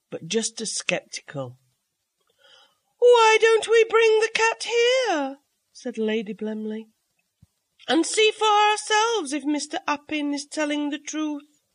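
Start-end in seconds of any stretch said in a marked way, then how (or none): 3.0-5.4 high, louder hostess voice, slow; Lady Blemley's voice. A narrator's reading. 7.9-11.6 hostess suggesting to her guests, high and bright; Lady Blemley's voice. A narrator's reading.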